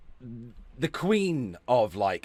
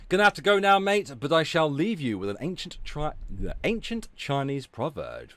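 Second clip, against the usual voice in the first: ordinary talk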